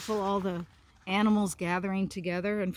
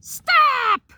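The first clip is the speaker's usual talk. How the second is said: a huge, much higher and louder shout; thunderous stop; 13 dB louder than usual. Acted. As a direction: boom it out to silence the whole quarrel. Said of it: "It rumbled loudly like thunder".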